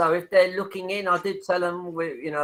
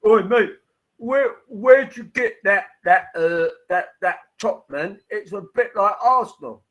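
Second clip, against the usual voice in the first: mock Cockney bluster, pitched up and louder; fake Cockney accent; 4 semitones higher, 6 dB louder than usual. Retelling a Cockney fan questioning his shirt, fake Cockney accent. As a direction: play a Cockney terrace lad, loud and cheeky, Cockney accent. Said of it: "a fake Cockney accent".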